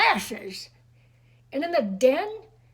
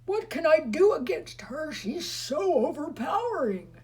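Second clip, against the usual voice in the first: put-on anxious boy; 7 semitones higher, 3 dB louder than usual. A narrator's reading. Fritz despairing.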